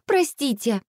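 high, panicky apology; horrified exclamation. A narrator's reading. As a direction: apologise in alarm. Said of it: "в ужасе воскликнула Василиса". (испуганно извиняется)

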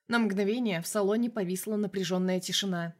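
ordinary delivery

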